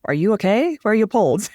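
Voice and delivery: high, mock-worried Alpine hiker; playful mock concern, voice up high. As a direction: ask it like a baffled German hiker spotting someone without poles. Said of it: "you'll be like".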